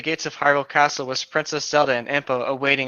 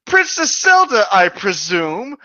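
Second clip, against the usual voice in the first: much higher, louder regal greeting; griffin lord voice; 6 semitones higher, 6 dB louder, slower than usual. Acted. Griffin greeting the princess, higher and grand. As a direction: greet her grandly, nostrils flaring. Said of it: "Dalamar said".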